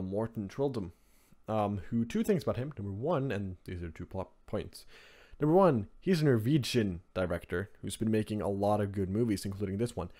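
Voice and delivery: ordinary delivery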